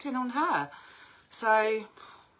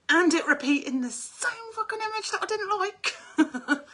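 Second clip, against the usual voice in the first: much higher, louder, laughing through it; exasperated giggle; laughing exasperation; 14 semitones higher, 10 dB louder than usual. Real, not acted. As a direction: laugh at your own frustration. Laughing at her own frustration.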